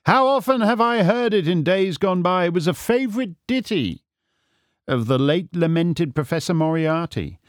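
airy storybook sleuth, high-pitched; amused, unruffled Holmes. Acted. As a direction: brush off the threat with theatrical relish. Holmes answers the threat lightly.

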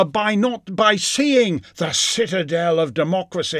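high, loud grandiose mockery; sarcastic parody of solemn patriotism; mock pompous patriot. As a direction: ham up the lofty speech. Mocking pompous patriotic view.

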